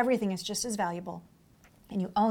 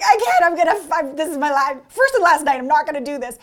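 higher and louder, panicky outburst; panicked protest; 4 semitones higher, 7 dB louder than usual. Retold or imitated. Showgirl backstage refusing to go on, panicky and loud. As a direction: blurt it like you're ready to quit. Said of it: "I'm just like".

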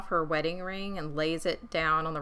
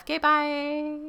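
high, drawn-out mock goodbye; awkward sign-off; 8 semitones higher, slower than usual. Host ending her own rambling voicemail, awkwardly singsong. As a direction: act out bailing awkwardly from a call. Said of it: "because I am so awkward on the phone".

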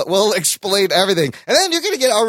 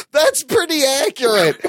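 after laughing hard; 8 semitones higher than usual. Real, not acted. Right after a laughing fit.

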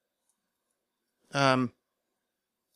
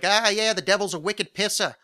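higher, hammed-up Boston accent; 7 semitones higher, 4 dB louder than usual. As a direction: put on a jokey Bostonian accent. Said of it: "done the accent".

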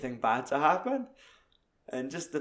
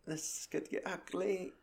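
hushed aside; 6 semitones higher, 11 dB quieter than usual. Retold or imitated. Barman voiced, tense.

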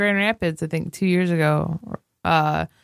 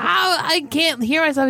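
higher-pitched protest; retold bafflement; 9 semitones higher than usual. Retelling own reply to a friend, baffled and high. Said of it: "I go".

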